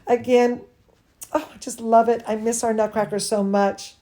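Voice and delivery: ordinary delivery, nothing marked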